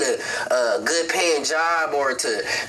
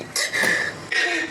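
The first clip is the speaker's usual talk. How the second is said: choked sob; 12 semitones higher than usual. Real, not acted. Father pleads to his dead son.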